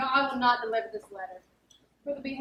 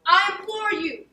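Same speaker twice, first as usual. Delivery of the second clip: high and loud, pleading slowly; 6 semitones higher, 11 dB louder, slower than usual. Acted. Plotter loudly pleading with the raging steward to calm down. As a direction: loudly beg him to calm down.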